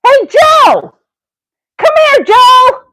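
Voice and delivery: high, carrying call across a room. Acted. Calling Joe over to come here, high call. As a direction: summon him from afar, pitch way up.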